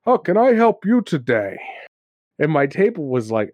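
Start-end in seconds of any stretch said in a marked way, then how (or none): 0.0-1.6 higher, hammed-up out-of-breath shopkeeper; breathless big baker. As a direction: burst in puffing and eager to serve. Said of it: "he'd be all out of breath".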